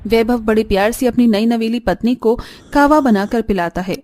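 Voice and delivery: ordinary delivery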